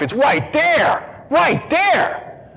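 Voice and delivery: high, drawn-out impassioned cry; retold outburst of longing; overwhelmed excitement. Retelling his longing for communion.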